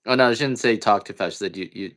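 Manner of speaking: ordinary talk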